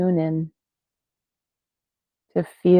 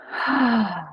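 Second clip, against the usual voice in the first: higher, falling audible sigh; 5 semitones higher, 3 dB quieter than usual. Shown as a demonstration. Teacher showing listeners how to sigh it out, audible sigh. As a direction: let the breath go in an open-mouthed "hah". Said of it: "And sigh it out".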